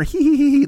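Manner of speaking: high, mock demure little giggle; cutesy giggle. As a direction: giggle like a coy, cutesy girl. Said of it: "She wasn't just some cutesy, like, demure".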